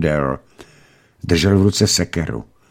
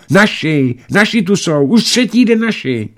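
put-on very high, joyful children's outburst; high-pitched child's excitement; excited blurting; 12 semitones higher than usual. A narrator's reading. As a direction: blurt the great news like thrilled kids. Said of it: "Oba vyhrkli najednou".

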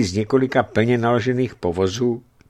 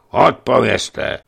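stern demand; 3 semitones higher, 7 dB louder, much slower than usual. Acted. Guard demands an answer.

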